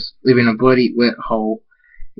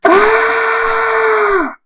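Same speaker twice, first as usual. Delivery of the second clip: long, high-pitched acted scream; 3 dB louder than usual. A narrator's reading. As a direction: hold one high, sustained scream. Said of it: "Big Mac screams".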